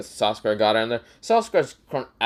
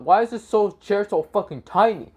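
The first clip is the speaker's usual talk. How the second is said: acted complaint; 8 semitones higher than usual. Bear complains about tiny chair.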